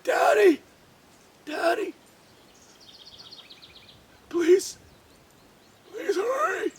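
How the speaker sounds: high, desperate begging; a frightened child begging her father; pleading. A narrator's reading. Sick daughter begs father to hurry, high and pleading. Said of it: "She said".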